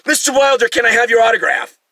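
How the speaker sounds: high, loud gushing fan voice; loud squealing mock waiter; starstruck waiter. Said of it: "The guy goes".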